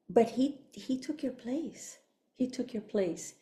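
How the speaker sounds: soft, slowed, reverent retelling; hushed. Retold or imitated. Speaker relives the preacher's words about Jesus, hushed. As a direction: let it drop to a quiet, weighty hush.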